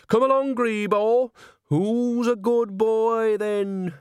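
put-on high, singsong, drawn-out baby talk; coaxing a pet. A narrator's reading. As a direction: lure the cat with slow cooing. Nanny coaxes her cat out.

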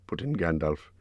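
usual speaking voice

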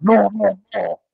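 teasing echo of a French-sounding waiter; playfully imitated French accent refusal; mock French accent. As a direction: mimic a friend's botched French-sounding Italian. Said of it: "French there? I thought you were in Italy, like".

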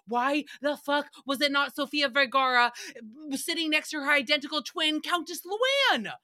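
high, mock-outraged question, stretching the final name; indignant disbelief. Host retelling their reaction to the talk show, indignant. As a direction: demand it in rising mock outrage.

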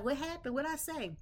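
high, puzzled what happened; mock confused surprise. Friend baffled when she gets up and leaves, mock surprised. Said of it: "you'll be like".

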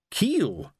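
high startled gasp of a name; shocked. Acted. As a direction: say it stunned, in disbelief. Lorne stares in shock.

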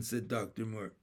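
ordinary delivery, nothing marked

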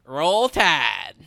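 drawn-out Southern drawl with swooping pitch. Shown as a demonstration. Offering a sample of the Southern accent his friend described, Southern drawl. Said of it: "you know, there's a drawl".

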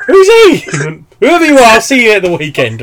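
high, loud, hammed-up outburst; mock promo shout. Wrestler dismissing an unknown opponent, hammed-up promo shout. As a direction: yell it like an indignant wrestler in a skit.

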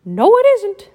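high, loud mouse voice; squeaky mouse voice; Mickey voice. Acted. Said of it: "said Mickey".

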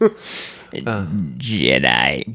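very slow, low villain drawl; hammed-up deep impersonation; Sidious impression. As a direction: stretch it out like a sinister emperor. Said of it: "Can you do the Sidious voice?".

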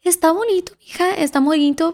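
a hint of a proud mother praising her daughter; imitating mom. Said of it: "And she's like".

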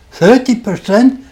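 high, loud, rising disbelief; surprised. Retold or imitated. Math professor to his student, surprised, high and loud. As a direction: blurt it out, genuinely taken aback. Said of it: "He actually looked surprised and said".

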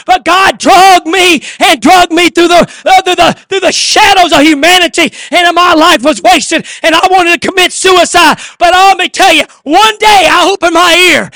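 loud, high, ringing sermon cry; fiery pulpit preaching; full-force preaching. Acted. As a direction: preach it out to the whole church.

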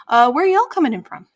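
lightly voiced friendly innkeeper; a hint of the owl woman asking kindly. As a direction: innkeeper asking guests where they're from. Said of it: "innkeeper voice continues".

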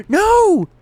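sudden high yelled no; a real shriek of panic; panicked yell. Real, not acted. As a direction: cry out in alarm at lost work. Progress didn't save, panic.

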